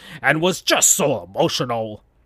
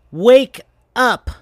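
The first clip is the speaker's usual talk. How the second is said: Sonic, higher and louder, rousing her; louder wake-up call; 10 semitones higher, 7 dB louder than usual. Acted. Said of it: "said Sonic to Amy as he kicked her".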